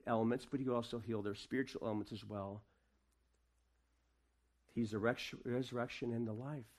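ordinary delivery

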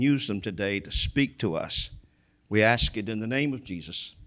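low, hushed pastor's prayer. Acted. Opening prayer before sermon.

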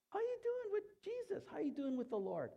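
high-pitched mock friendly greeting; chirpy church friend. Someone asking a churchgoer about faith, high chirpy voice. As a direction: put on a high voice for a casual check-in.

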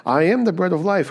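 ordinary talk

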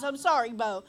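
usual speaking voice

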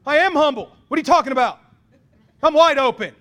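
high, very loud indignant protest; defensive outburst. Acted. Rebuked man answering his mentors, loudly defensive. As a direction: shout back in mock disbelief.

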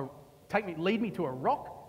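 ordinary talk

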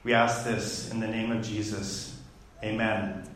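low, softened prayer voice; opening prayer. Real, not acted. Pastor asking God for wisdom before the message, prayerful.

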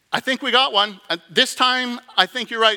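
high, loud eager hope; the angel can't contain himself; excited angel. Acted. Angel excited about tax collector.

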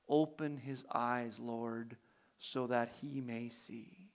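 soft, low, unhurried prayer; quiet prayer. A narrator's reading. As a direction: pray it gently under the breath. Elisha prays.

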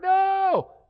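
sudden, very high, loud mock wail; the parent refuses the news with a shriek; heartbroken parent's cry. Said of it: "You're like".

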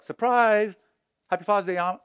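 high, loud mock "surprise!" reveal; spring a fake gotcha on the audience. Congregation teasing the pastor, mock surprised shout.

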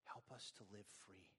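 soft, subdued prayer with long pauses. Real, not acted. Preacher praying for congregation to live free, hushed prayer. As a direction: pray quietly, letting each phrase settle.

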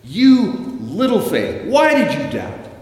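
loud, high angry rebuke; furious shout, each phrase hit hard. Shown as a demonstration. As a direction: thunder it as an accusation. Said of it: "Is he angry at Peter?".

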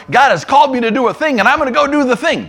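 fast, high, loud eager intern; gung-ho zeal. Acted. Zealous intern leaving prayer meeting to act, gung-ho zeal. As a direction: burst out, raring to go. Acting his over-eager younger self.

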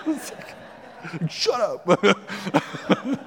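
laughing through a mock shut up. Husband laughing back at his wife's told-you-so. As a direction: tease back while laughing.

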